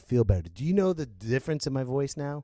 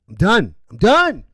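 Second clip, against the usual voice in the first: loud, climbing higher each time; fed-up outburst; 6 semitones higher, 11 dB louder than usual. Real, not acted. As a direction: escalate sharply into a loud cry. Repeats it, escalating.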